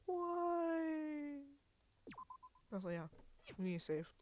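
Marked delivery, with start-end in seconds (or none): 0.1-1.4 high thin mock wail. Gamer's last hammed-up why over the lost character. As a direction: let the last why float up, spent.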